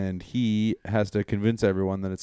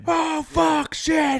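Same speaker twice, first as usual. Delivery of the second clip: panicked shout; much slower than usual. Retold or imitated. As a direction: yelp it out in a panic. Everyone reacting in the cave.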